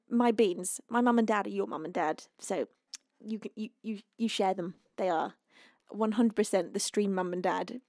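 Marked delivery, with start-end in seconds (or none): none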